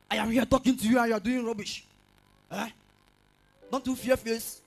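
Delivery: put-on bullying campus boy snapping at a girl; angry scolding. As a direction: tear into her for ignoring you. Boy berating girl angrily.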